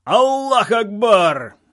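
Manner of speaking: high, loud, drawn-out chant shout; long rousing war cry, pitched high; battle-cry yell. A narrator's reading. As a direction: yell it as a crowd of fighters would. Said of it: "а потом дружно заорали".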